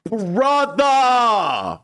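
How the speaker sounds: high, loud, long drawn-out "Brother!"; stunned cry. Real, not acted. Telling viewers the puppet scene shocked him, high loud stunned cry. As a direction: shout it out in disbelief.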